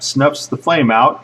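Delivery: ordinary delivery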